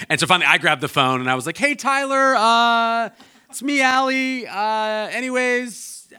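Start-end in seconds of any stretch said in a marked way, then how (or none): 1.6-6.1 mock-awkward voicemail, very high drawn-out words. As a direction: stall in a high, embarrassed squeal of a voice. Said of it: "And it was awkward".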